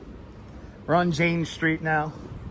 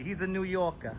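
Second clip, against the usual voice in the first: put-on softly echoed New York accent; 7 dB quieter than usual. Streamer describing his departing friend, New York accent. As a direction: say New Yorker with a local lilt. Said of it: "It's a John Lore accent".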